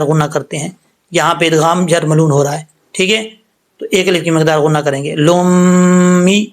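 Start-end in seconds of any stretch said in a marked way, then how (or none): 5.1-6.4 لُمِّ held very slowly, no qalqala on the lam; slow recited demo. Shown as a demonstration. Teacher models لُمِّ without qalqala, very slow. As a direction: stretch لُمِّ gently, lam stays still. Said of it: "لام جو ہے ساکن ہے".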